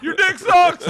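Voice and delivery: high, loud jeer through laughter; laughing mock yell; mock heckle. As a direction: heckle the performer from the crowd. Retold heckling of a performer.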